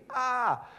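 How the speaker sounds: high startled cry; mock panicked gasp. Israelites panicking at the Red Sea, high yelp.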